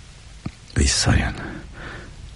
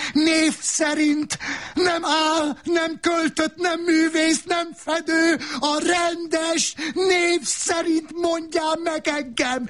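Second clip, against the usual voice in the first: sustained high-pitched howl. A narrator's reading. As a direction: scream each phrase out, stretched and relentless. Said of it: "Üvöltötte".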